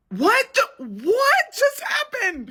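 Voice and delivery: very high, slow astonished cry; stunned disbelief. Real, not acted. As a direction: gasp at the glitch. Filter breaks.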